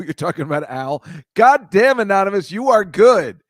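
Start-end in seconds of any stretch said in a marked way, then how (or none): 1.3-3.4 real burst of loud, high delight; amazed praise. Real, not acted. Host to chatter who remembered Al, amazed praise. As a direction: exclaim it, impressed and much louder.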